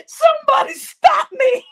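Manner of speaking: high, laughing plea; begging through giggles; laughing fit. Real, not acted. Helpless laughter telling story.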